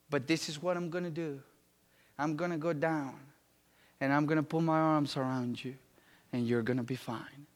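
low, soft, reassuring voice of God; gently retold divine reassurance, pitched low; voice from heaven. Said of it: "she heard a voice from heaven saying".

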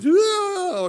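high, loud delighted oh; excited gasp. Retold or imitated. Wife unwraps the bone china gift, high and thrilled. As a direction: squeal with delight.

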